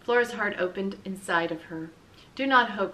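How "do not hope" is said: usual speaking voice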